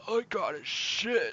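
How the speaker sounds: put-on slow, quiet old man croak. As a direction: groan it out like a frail old man. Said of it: "the old man voice".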